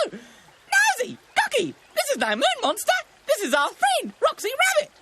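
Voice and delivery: high, loud excited bird; piercing cartoon magpie; magpie shouting. A narrator's reading. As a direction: shout the revelation in a squawky voice.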